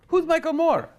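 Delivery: squeaky high confused question; mock puzzled. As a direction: play the baffled soldier. Soldier's confused reply.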